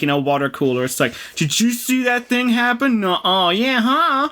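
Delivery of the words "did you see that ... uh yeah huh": put-on high, bickering kid voice; childish argument. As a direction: bicker like a schoolkid. Acted water cooler argument.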